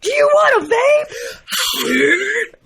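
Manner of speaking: high, slow, yelled hype; monster truck ad. Pretend. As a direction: scream it like a truck rally ad. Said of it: "We could just yell at it".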